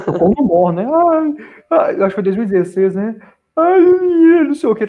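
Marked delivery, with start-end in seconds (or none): 0.9-1.9 high, louder-edged mock "ai, ai" whimper; mock whining. Retelling a man's whining on the show, mock whining. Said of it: "tava choramingando". 3.6-4.9 high-pitched, quick imitation of whining; mock whining. Retelling how the other guy complained, mock whining. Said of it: "tava choramingando".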